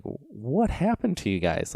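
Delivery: mock dismay at the kids going wild; bewildered question. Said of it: "I'm looking at these kids going like".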